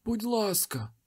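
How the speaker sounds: high desperate pleading; beg, pitched high and thin; frightened high begging. A narrator's reading. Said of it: "попросив той чоловік".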